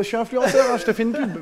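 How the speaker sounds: lightly voiced entitled influencer; mock influencer. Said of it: "ils rentrent dans les restos".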